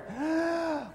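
sharp high gasp for breath; gasp for air. Acted. Pastor comes up from under the wave, gasping for air. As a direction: gulp air as you break the surface.

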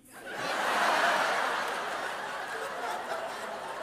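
a high, breathy burst of laughter; big laugh. Real, not acted. Congregation-style outburst of laughter.

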